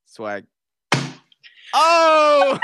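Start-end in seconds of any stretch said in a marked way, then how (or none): 1.7-2.6 sudden high startled yelp; startled cry; surprised shout. Real, not acted. Reacting to Bible being smacked.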